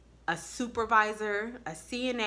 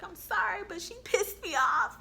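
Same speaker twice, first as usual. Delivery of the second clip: whiny excuse; 13 semitones higher, 5 dB louder than usual. Retold or imitated. Retold excuse to the RN.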